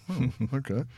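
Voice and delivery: a short laugh through an amused okay; bemused laugh. Retold or imitated. Telling how she reacted to [name]'s insistence, with a bemused laugh. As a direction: laugh it off, half disbelieving.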